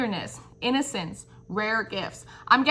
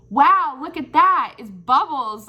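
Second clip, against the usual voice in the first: delighted amazement; 4 semitones higher, 5 dB louder, slower than usual. Retold or imitated. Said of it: "you're gonna be like".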